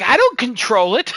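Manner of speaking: retold helpless protest, voice jumping up; defensive protest. Player blamed for a missed shot, hammed-up defensive protest.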